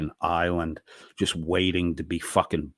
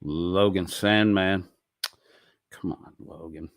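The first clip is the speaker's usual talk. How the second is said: ordinary delivery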